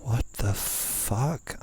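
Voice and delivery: drawn-out, stunned what the fuck; slow disbelief. Real, not acted. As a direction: let it stretch out in slow disbelief. Shocked at screen.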